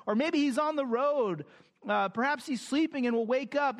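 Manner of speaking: mock the prophets as Elijah would; mocking taunt. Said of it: "as he mocks them".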